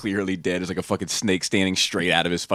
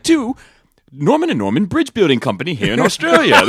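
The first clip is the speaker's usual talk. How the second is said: loud, high, hammy Australian ad voice; a Crocodile Dundee style Australian accent; 12 semitones higher, 9 dB louder than usual. Retold or imitated. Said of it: "horrible Australian accent".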